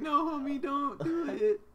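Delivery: high, loud cartoonish plea; squealed comic begging; mock pleading. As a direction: wail it in hammed-up protest. Acts out pleading with accusers.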